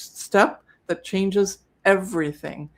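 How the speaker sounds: slow, deliberate emphasis on each word; emphatic repeat. Shown as a demonstration. Host repeating the key step for sellers, slow and emphatic. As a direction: spell it out slowly to drive the point home. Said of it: "So I'll say it again".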